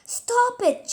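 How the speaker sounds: high, loud, ringing Stop it!; angry girl shouting at a screaming boy to stop; furious shout. A narrator's reading. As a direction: yell it at the boy to make him stop. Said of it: "she shouted at him".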